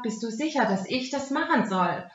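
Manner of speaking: normal talking voice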